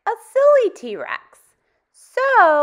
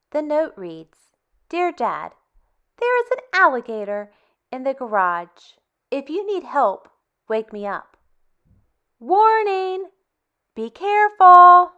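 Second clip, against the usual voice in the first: put-on reading to children; 4 dB louder than usual. Teacher reading a picture book to kids, lively and expressive. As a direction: tell it like a bedtime story to little ones.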